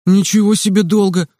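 mild indignant protest. A narrator's reading. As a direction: push back, offended, stress on ничего. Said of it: "возмутился Кирилл". (возмущённо, с ударением на «ничего»)

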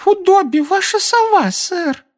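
very high squeaky house-elf. Acted. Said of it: "раздался писклявый голос".

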